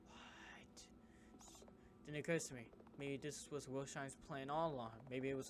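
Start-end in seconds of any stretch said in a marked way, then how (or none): 0.1-0.8 breathless whispered what; barely voiced shock; startled whisper.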